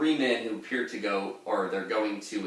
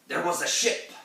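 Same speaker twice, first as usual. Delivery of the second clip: much higher, louder put-on sailor voice; old mariner impression; 9 semitones higher, 4 dB louder than usual. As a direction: croak it out like an old sea captain. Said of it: "back to the impression".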